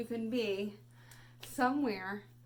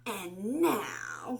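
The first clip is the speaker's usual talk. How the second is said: cried out; 6 dB louder than usual. Acted. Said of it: "cried Max".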